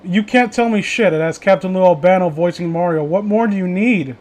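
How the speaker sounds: ordinary talk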